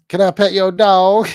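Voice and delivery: high, loud mock little-girl Southern voice; Southern accent. Streamer quoting the funny zoo video, Southern accent. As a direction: belt it out as a tiny Southern girl. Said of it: "like, this Southern accent".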